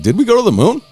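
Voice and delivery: high-pitched imitation of online doubters; mock questioning. Voicing online right-wingers questioning the landing.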